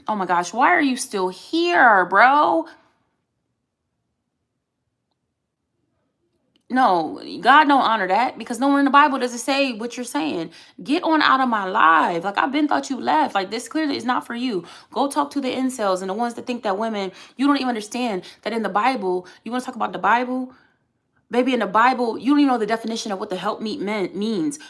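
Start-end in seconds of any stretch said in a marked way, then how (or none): 0.1-12.2 louder and higher, fed up with a commenter; exasperated telling-off. Real, not acted. 21.3-22.2 scornful scolding. Real, not acted. Scolding commenter.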